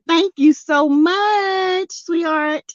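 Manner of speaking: high, slow and sweet thank you. Real, not acted. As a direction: draw out the thanks fondly. Thanks viewer for super sticker.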